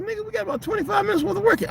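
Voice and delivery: retold retort. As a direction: fire it back high and fast, fed up. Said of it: "I was like".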